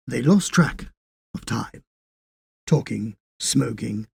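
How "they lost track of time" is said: ordinary delivery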